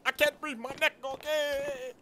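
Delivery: high, strained, soft gasping pleas; high choking voice; choked friend. Acted. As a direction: beg while being strangled. Crypto being choked.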